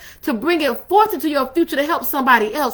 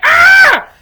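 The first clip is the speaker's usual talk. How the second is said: acted high, loud cringing shriek; hammed-up panicked protest, shouted; freaking out; 9 semitones higher, 9 dB louder, slower than usual. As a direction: yelp in alarm at being touched. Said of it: "I freaked out".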